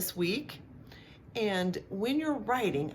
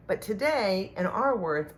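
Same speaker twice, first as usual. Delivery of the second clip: usual speaking voice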